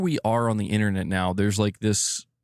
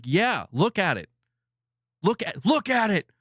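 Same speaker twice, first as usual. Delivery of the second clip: exasperated insistence; 11 semitones higher than usual. Real, not acted. Streamer telling viewers to look at the game, exasperated insistence. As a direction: voice climbing high in insistence.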